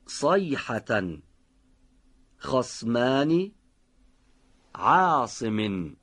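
صادقان and قصصنا drawn out slowly with a heavy, full-mouthed ص; slow careful recitation. Shown as a demonstration. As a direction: recite each Quranic word slowly, weighting the emphatic ص. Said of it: "لطفاً دقت بفرمید. بشنوید".

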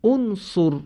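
انصر said clearly with a rounded opening vowel "onsor"; model pronunciation. Shown as a demonstration. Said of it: "به این فعل امر نگاه کنید". (تلفظ نمونهٔ انصر با ضمه)

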